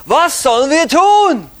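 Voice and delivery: loud, high-pitched demanding question; crowd asking. Retold or imitated. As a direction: call it out like the crowd pressing Jesus. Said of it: "Die Juden fragten Jesus immer wieder".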